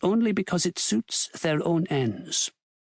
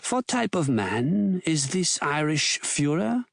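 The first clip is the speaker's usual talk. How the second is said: usual speaking voice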